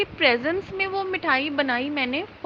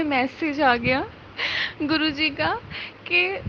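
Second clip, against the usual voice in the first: usual speaking voice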